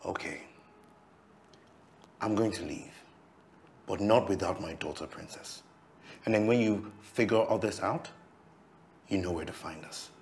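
soft, low, subdued announcement; quiet resignation. Acted. Man leaving with his daughter, quiet resignation. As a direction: withdraw quietly but firmly.

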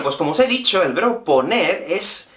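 usual speaking voice

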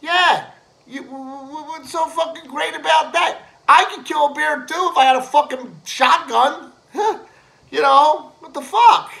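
high, loud ranting disbelief; indignant rant. Real, not acted. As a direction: scoff at it, worked up. Incredulous rant about bear hunting.

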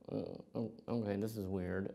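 hushed, hesitant aside; retold quiet unease. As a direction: mutter it softly, unsure. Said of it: "It's real quiet".

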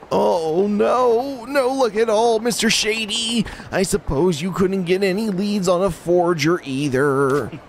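whiny lovesick man. Acted. Fool despairing to Majima about his problem. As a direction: play the pathetic lovestruck fool.

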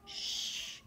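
soft hushing shh; shushing. Acted. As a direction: hush gently, someone's asleep. Zookeeper's wife asleep.

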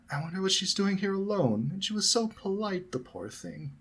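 high, pitying motherly voice; full of concern. A narrator's reading. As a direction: worry aloud, softening away at the end. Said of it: "her voice full of concern".

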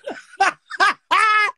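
loud high laugh bursting out; burst of laughter. Real, not acted. Listener bursting out laughing at the roach story. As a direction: crack up hard at the punchline.

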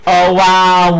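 ordinary talk, nothing marked

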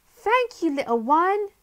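chirpy grateful thanks, pitched well up; high bright girl. A narrator's reading. Polly thanks the little rabbit, high and bright.

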